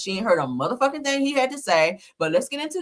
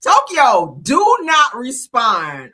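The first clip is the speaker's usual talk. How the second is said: loud warning, slowing on do not respond; urgent warning; 10 dB louder, much slower than usual. Real, not acted. Host warns Tokyo loudly not to answer back. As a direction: plead with her not to answer. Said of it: "I'm trying to help you".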